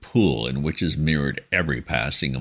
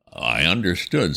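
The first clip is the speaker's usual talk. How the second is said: ordinary delivery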